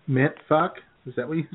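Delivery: ordinary delivery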